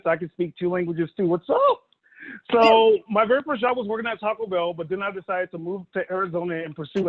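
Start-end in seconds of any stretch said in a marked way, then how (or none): 1.3-1.8 high greeting. Acted. Showing off his two languages.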